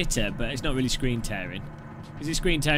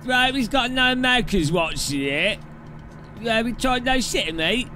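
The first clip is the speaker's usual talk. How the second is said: hammed-up British accent, pitched up; exaggerated British bloke, higher voice; put-on British accent; 8 semitones higher than usual. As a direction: lay the British accent on thick. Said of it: "like, that accent".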